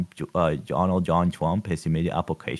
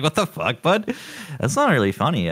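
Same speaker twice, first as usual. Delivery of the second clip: mock indignant; 10 semitones higher, 4 dB louder than usual. Mock reaction voiced after laugh.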